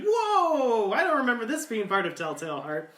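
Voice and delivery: high, loud startled whoa; astonished audience. Retold or imitated. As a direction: blurt it out in shock at the rockets. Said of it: "the audience is like".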